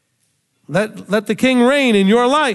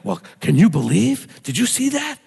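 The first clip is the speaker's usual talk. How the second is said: gossipy tone; 2 semitones higher than usual. Retold or imitated. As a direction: act shocked to stir up gossip. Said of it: "they see the tone of my voice".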